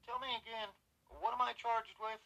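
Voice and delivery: high-pitched student challenging an officer. A narrator's reading. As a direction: call out the question high and loud. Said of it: "Doug called out in his high-pitched voice".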